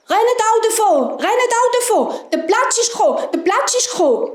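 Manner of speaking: high, urgent warning cries; animals calling alarm. A narrator's reading. All the animals warn the boars, high alarmed calling. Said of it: "alle Tiere haben gerufen".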